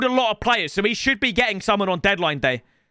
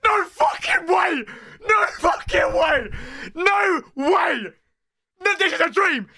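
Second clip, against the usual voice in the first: shocked shout; 7 semitones higher than usual. Real, not acted. Shocked at the player reveal.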